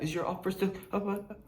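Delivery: soft, timid asking; sheepish seller. Retold or imitated. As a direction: creep back hesitantly. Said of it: "The seller comes back like that".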